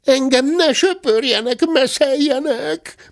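very high wailing protest; high, howled complaint; bawling cub. A narrator's reading. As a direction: bawl like an outraged little bear. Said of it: "bömbölt Zebi".

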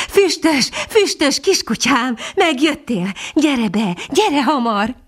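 high, happy call to the dog; joyful calling. A narrator's reading. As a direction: call the lost dog home gladly. Woman calls the dog.

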